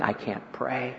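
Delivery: a soft, hopeless "I can't pray"; hopeless murmur. Retold or imitated. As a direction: let it sink away in despair. Voicing a despairing patient.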